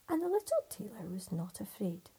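usual speaking voice

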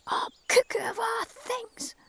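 grumbling Scots crow, slow then a high loud squawk; a cross old crow, caught off guard; lightly voiced crow. Crow complaining after the mid-air crash. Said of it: "The crow looked up and said".

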